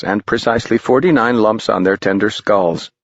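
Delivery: ordinary delivery, nothing marked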